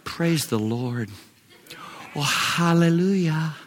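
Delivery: mock timid hushed praise; timid worship. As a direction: imitate a reserved, half-hearted worshipper.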